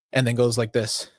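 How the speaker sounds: normal talking voice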